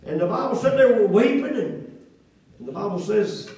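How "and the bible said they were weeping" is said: rising, louder preaching cadence. Acted. Pastor exhorting the congregation, emphatic preaching.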